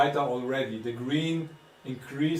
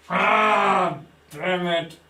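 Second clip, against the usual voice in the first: frustrated shout; 5 semitones higher, 4 dB louder, much slower than usual. Real, not acted. Frustrated at failing device.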